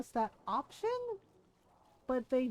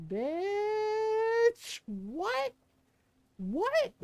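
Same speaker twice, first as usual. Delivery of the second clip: much higher, incredulous cry; higher shocked bitch what; stunned disbelief; 8 semitones higher, 3 dB louder, much slower than usual. Retold or imitated. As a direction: squeak out the shock. Disbelief at the betrayal.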